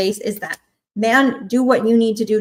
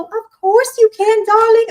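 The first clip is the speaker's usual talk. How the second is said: gushing; 10 semitones higher, 4 dB louder than usual. Real, not acted. Eager reply to client question.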